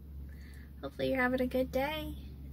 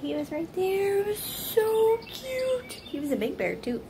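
high, louder gush, stretched at the end; excited gushing; 9 semitones higher, 4 dB louder than usual. Real, not acted. Telling viewers about the bear that came close, excited gushing. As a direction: coo with delight, voice climbing high.

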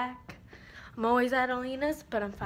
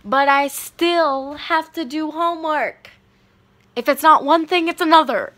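exasperated; 4 semitones higher, 15 dB louder than usual. Real, not acted. Exasperated about homework.

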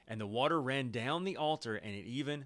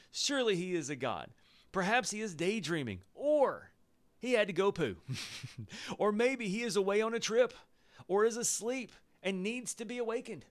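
higher, louder clowning taunt; mocking; 8 semitones higher, 3 dB louder than usual. Elijah clowning the prophets, higher and louder mockery. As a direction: ham up the ridicule, higher and louder. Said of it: "So let's read that again".

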